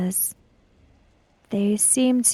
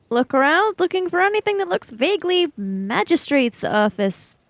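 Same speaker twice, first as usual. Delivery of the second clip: raised, odd character voice; lightly voiced character; 7 semitones higher, faster than usual. Player voicing Chiaki as he looks for the magistrate's office. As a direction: pitch the voice up for the character.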